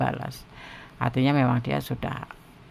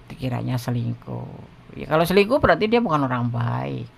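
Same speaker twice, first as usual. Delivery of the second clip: ordinary talk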